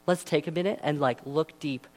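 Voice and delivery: normal talking voice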